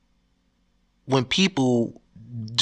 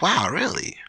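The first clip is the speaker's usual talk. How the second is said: mock disbelief; 6 semitones higher than usual. Reacting to [name] tweet.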